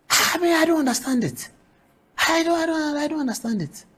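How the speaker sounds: high, hushed, stunned disbelief; baffled disbelief. Real, not acted. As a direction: give up trying to understand it, falling away quietly. Host baffled by the remark.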